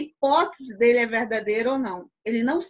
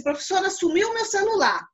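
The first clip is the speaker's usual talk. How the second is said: lightly acted pupil, raised pitch; pupil's complaint; 7 semitones higher than usual. Student tells teacher the phone vanished, mild alarmed complaint. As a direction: report it to the teacher, higher.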